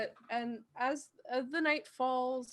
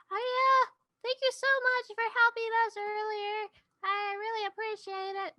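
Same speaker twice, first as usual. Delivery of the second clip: lightly voiced Robin; 9 semitones higher than usual. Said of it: "Robin is like".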